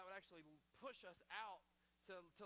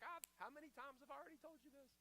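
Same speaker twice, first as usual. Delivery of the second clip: exasperated parent; 7 semitones higher than usual. Retold or imitated. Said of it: "those conversations with your kids".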